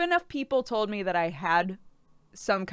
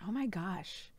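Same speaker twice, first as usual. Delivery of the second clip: whispered; 11 dB quieter than usual. Real, not acted. Shocked realization about Krender.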